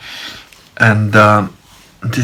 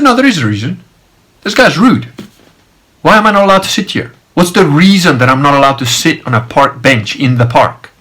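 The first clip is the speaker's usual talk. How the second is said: higher-pitched, insistent objection; indignant protest; 4 semitones higher than usual. Retold or imitated. Man challenges guard's lady friend over bench, indignant. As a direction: retell demanding a reason, indignantly.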